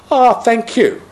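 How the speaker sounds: high, gushing lady's thanks; a light imitation of a grateful woman. Well-dressed woman thanks speaker.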